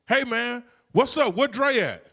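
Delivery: upbeat greeting. Retold or imitated. Casual call to brother.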